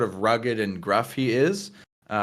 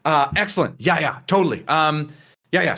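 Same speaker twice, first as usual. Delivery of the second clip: higher-pitched, flustered enthusiasm; eager agreement; 6 semitones higher than usual. Acted. Flynn to his doorman on hearing his visitor is here, eager agreement. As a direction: jump on the news eagerly. Game master voices Flynn greeting news.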